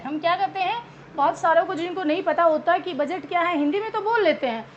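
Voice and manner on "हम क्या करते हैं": ordinary talk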